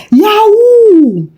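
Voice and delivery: loud high shriek of alarm; shouted. A narrator's reading. Tenants crying out in shock about fraud, loud high shout. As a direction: yell it out all together, way up high. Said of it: "they shouted".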